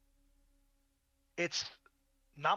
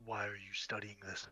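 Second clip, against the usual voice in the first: low, quiet gruff interrogator; a gruff, low threat; 5 semitones lower, 6 dB quieter than usual. Acted. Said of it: "You hear a gruff voice".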